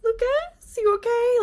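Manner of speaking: high worried call across the room. Retold or imitated. Fiancee calling to her sick partner in another room, loud and worried. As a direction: call his name up high, anxious.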